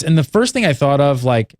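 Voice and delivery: ordinary delivery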